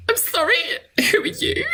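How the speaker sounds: very high, tearful squeak; crying girl. Acted. Crying girl questioning strangers at her table, tearful. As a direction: squeak it out as a mock-weepy girl. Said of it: "the crying girl".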